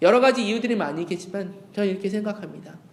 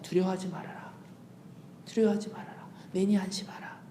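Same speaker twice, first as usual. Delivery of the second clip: soft comforting; 9 dB quieter than usual. Acted. Preacher voices Jesus comforting.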